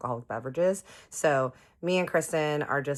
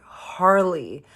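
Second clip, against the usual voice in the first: stunned name; 4 semitones higher, 8 dB louder than usual. Retold or imitated. Stunned recipient of signed guitar.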